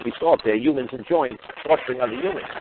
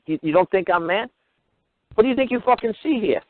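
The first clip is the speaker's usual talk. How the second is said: ordinary talk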